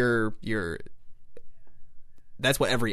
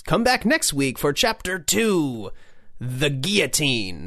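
serial announcer; 2 semitones higher, slower than usual. Acted. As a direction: announce next week's chapter with drawn-out drama. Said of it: "and then goes".

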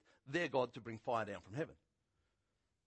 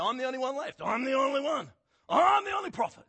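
high, loud whiny lament; self-pitying Elijah; 12 semitones higher, 11 dB louder than usual. Retold or imitated. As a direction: wail about being the only one left. Elijah self-pity voiced in story.

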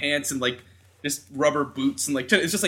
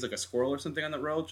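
ordinary talk

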